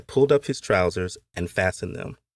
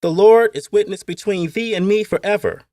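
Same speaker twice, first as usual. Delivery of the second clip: put-on high, loud child reciting solemn words; boy quoting scripture; 11 semitones higher, 7 dB louder than usual. A narrator's reading. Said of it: "Kumi had quoted out of the blue".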